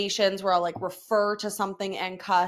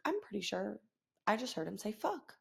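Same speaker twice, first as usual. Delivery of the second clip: much softer, confiding disbelief; shocked aside; 12 dB quieter than usual. Retold or imitated. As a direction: retell it as a hushed, stunned aside. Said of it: "I was so shocked".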